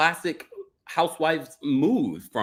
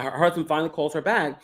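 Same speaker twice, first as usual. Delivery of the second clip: usual speaking voice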